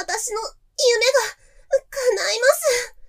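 very high, loud and slow, stretched; ecstatic moan. Acted. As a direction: draw each word out in rapture. Climax approaching. (高く大きくゆっくり恍惚と)

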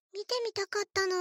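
ordinary delivery

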